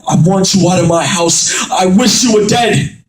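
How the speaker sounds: dad yells he wants his son gone; dad yelling at his son after the fight, furious; furious shout. Acted.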